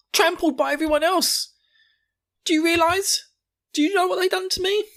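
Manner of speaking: very high, upset put-on voice; Gabby. Gabby to Falco about the crushed friend, high mock distress. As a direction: protest in a high, overwrought child's voice. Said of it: "He pulls her back and says".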